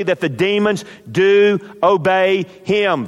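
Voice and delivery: usual speaking voice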